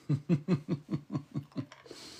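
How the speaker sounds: high breathy giggle; playful little laugh. Real, not acted. Laughing after flirty line.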